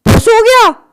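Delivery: squeaky high cry of dismay; sudden high disbelief; shocked squeal. Real, not acted. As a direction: yelp as the rocket fizzles. Rocket fizzles out on launch.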